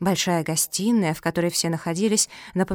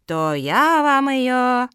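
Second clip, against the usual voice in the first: drawn-out, emphatic refusal; curt refusal; 4 semitones higher, 5 dB louder, much slower than usual. Acted. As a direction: refuse slowly, leaning hard on each word. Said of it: "отрезала Зоя".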